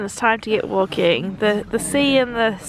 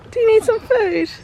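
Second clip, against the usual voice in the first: high cooing pet voice; 12 semitones higher, 5 dB louder than usual. Real, not acted. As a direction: coo at the pig. Talking to a pig.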